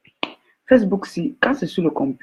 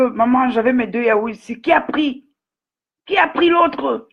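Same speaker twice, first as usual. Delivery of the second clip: high, whiny son's voice; son complaining; 6 semitones higher than usual. Retold or imitated. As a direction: play the upset son, pitched higher. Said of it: "il va demander que".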